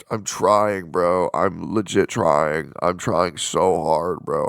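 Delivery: loud, slow, strained pleading. Pretend. Streamer insisting he's trying, loud, slow strained plea. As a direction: push the words out like it's all too much. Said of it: "My anxiety's through the roof".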